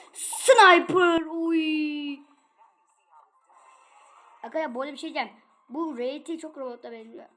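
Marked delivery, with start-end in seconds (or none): 0.1-2.2 very loud drawn-out cry of "sniper"; startled shout. Real, not acted. Gamer yelling at a sniper in the match, startled shout. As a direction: shout it out, much louder and higher.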